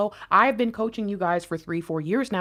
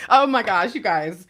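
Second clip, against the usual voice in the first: normal talking voice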